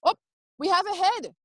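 high delighted exclaim; mock surprise. Midwife spotting the baby's head, high mock surprise. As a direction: lift it high in amazement.

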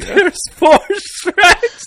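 loud high shocked cry; shocked shout. Real, not acted. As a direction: shout it in disbelief. Shocked outburst.

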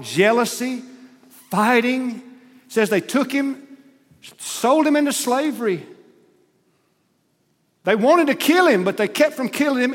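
preacher's crescendo over the brothers' betrayal; rising preacher fervor. Real, not acted. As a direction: build it up like a sermon peak. Preacher building to a climax.

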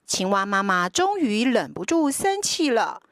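ordinary delivery

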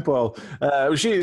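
ordinary delivery

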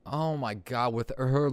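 normal talking voice

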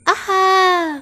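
high, squeaky voice, thrilled with the cloth; a tiny mouse gasping over a treasure; delighted little mouse. Acted. Mouse admires his red scrap.

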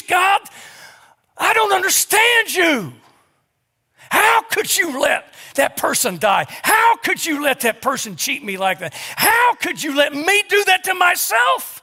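high, loud, pleading yells; preacher acting out a grieving believer shouting angry questions at God; anguished shout at God. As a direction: hurl the questions at God, slowly and raw. Acted angry prayer to God.

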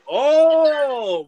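loud, high, drawn-out crowd "oh"; crowd reaction. Retold or imitated. Imitating fans reacting to Lampert's insult, mock crowd excitement. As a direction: imitate a crowd going wild. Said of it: "And then going".

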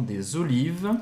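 "des olives" linked with a Z, "dé-zolives"; liaison. Shown as a demonstration. Teacher showing how the words join, liaison. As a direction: run "des" into "olives" on a Z sound. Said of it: "Fazendo a ligação".

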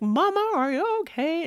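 put-on high, loud child's cry of concern; worried child. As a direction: imitate an alarmed kid checking on mom. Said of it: "Miles like".

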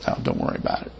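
softly whispered, letting it go; a hushed, forgiving aside from the king; gracious whisper. Pretend. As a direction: breathe it out as a kindly pardon. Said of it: "could have just graciously said".

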